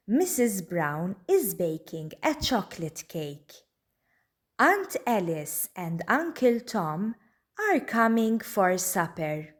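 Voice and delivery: plain reading voice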